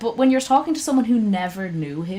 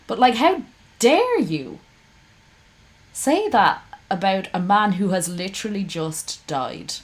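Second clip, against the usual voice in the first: how dare you, incensed; raised voice in disbelief; outraged; 4 dB louder than usual. Real, not acted. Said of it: "I am so angry at this man".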